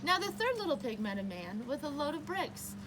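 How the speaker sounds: usual speaking voice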